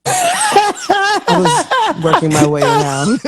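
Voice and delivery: high, slow laughing. Real, not acted. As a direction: giggle it out. Giggling at cologne joke.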